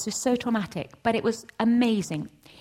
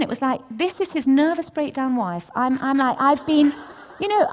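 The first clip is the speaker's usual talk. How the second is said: ordinary talk, nothing marked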